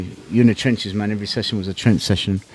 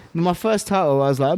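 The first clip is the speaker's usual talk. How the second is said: ordinary talk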